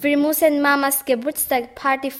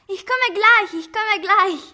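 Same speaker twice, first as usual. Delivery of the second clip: alarmed; 6 semitones higher than usual. Acted. Mutter reagiert alarmiert.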